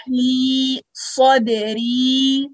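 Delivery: drawn-out chanted ली Saudari; slow recitation. Shown as a demonstration. Teacher reciting the phrase slowly for students.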